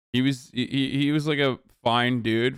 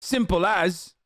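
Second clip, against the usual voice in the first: firm and certain, higher; with conviction; 6 semitones higher, slower than usual. Shown as a demonstration. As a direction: land it like a final verdict. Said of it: "like, say it with conviction".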